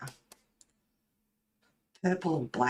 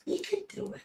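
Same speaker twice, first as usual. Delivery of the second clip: tiny high squeak of encouragement; squeaky cheer; 4 dB quieter, faster than usual. Pretend. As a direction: cheer them on in a much higher, softer squeak.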